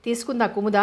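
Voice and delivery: ordinary delivery, nothing marked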